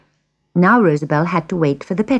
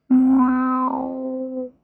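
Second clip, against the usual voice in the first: drawn-out, high, mournful meow; long sad meow; 7 semitones higher, 3 dB quieter than usual. A narrator's reading. Cat answers the woodcutter, long sad meow. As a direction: mew sorrowfully and hold it. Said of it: "gave a long, sad".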